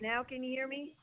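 speakerphone voice, breaking up; calling in on a bad line; over the phone. Real, not acted. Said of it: "you on speaker there".

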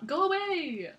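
high-pitched, overacted "go away"; hammed-up yelling. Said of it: "that was bad, bad acting on my part".

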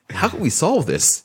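high, slow, soothing question; mock patient helper. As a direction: gently offer to fix it. Calm reply to a yelling client.

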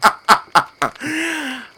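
high burst of laughter. Real, not acted. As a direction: laugh out at the reaction. Teller's own laugh.

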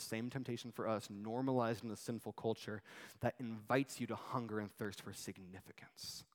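ordinary talk

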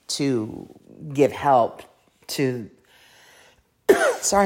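tickle in the throat, voice catching; talking through a throat tickle; frog in throat. Real, not acted. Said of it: "I have a tickle in my throat".

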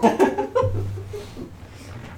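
big loud burst of laughter. Real, not acted. Big laugh after outburst.

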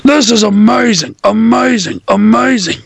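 high commentator impression, word looping. Recalling a promo, imitated looping commentator cry. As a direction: repeat it identically like a scratched clip. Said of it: "scratch repeat of J R just saying three times".

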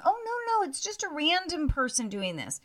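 high-pitched, feigned innocence; mock denial. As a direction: imitate someone brushing off an accusation. Mimicking a denial about the texts.

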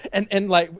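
ordinary talk

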